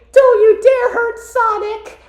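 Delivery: very high, slow, loud falsetto; exaggerated girlish defiance; protective cartoon girl. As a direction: defend your friend in a squeaky voice. Acted Amy defending Sonic.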